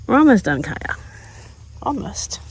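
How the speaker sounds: pet talk. Real, not acted. Owner promising her puppy Kaya the watering is almost done. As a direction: promise the dog it's nearly over.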